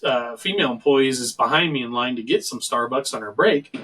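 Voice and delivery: ordinary talk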